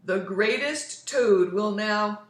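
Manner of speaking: loud, slow, grand presenter voice; booming far-off announcer. Acted. Strange voice presents Toad in his dream, booming announcer. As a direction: announce him like a showman. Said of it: "Then the voice said".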